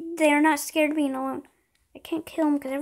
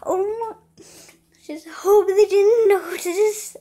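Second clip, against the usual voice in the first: high, slow, whimpering worry; fretting almost in tears; nervous crying out; 4 semitones higher, 5 dB louder, much slower than usual. Real, not acted. Nearly caught killing.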